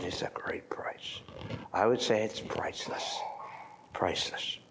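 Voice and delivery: hushed, awed whisper. Real, not acted. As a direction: lean in and whisper the point. Preacher whispers for emphasis.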